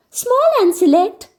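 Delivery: put-on a young girl promising it's only a small party; Anne reassuring. A narrator's reading. Narrator voicing Anne reassuring Marilla, high bright girl voice. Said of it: "Anne assured Marilla".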